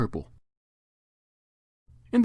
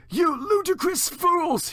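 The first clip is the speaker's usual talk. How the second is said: high, very slow angry shout; drawn-out scolding bellow; 3 dB louder, much slower than usual. Acted. Dumbledore scolding the pair, high slow furious shout. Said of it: "he shouted".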